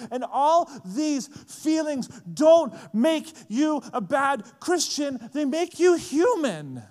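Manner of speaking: high, loud, word-by-word declaration; emphatic preaching. Real, not acted. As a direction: drive home each word slowly and forcefully. Preacher driving home her point.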